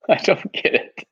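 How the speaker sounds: breathy laugh through the words. Real, not acted. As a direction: giggle while saying it. Laughing at the nickname.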